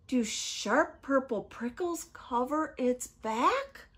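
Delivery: wondering storyteller with lifted, questioning line endings; suspenseful story reading. A narrator's reading. Storyteller asks children about the creature, suspenseful. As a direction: build each question for young listeners.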